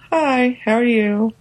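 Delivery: timid, high little hello; shy greeting. Retold or imitated. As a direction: greet like a nervous wallflower. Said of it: "in person, I'm just kinda like".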